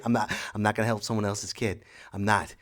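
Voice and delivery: ordinary delivery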